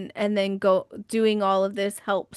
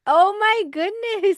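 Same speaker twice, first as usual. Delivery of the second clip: high, slow cry of amazement; really stunned, drawing out each word; astonished; 11 semitones higher, 4 dB louder, slower than usual. Real, not acted. Reacts to the nickname reveal.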